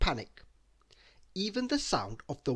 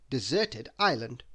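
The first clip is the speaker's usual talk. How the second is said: usual speaking voice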